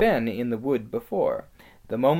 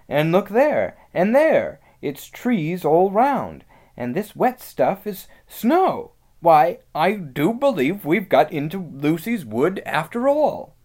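loud, raised voice of excited discovery; amazed Peter; 6 semitones higher, 7 dB louder than usual. Acted. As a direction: marvel at each new sight, pitched up.

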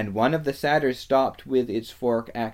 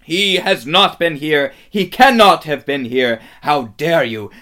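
loud, raised, emphatic outrage; furious denial; 4 semitones higher, 12 dB louder than usual. A narrator's reading. Witch rejects the fox's news, furious denial. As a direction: hammer out each denial angrily.

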